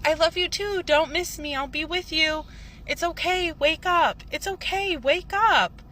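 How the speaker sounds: high, insistent loving reassurance; acted parental comfort, pitched well up; reassuring dad. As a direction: retell a father urging his child awake. Dad's loving farewell in retold dream.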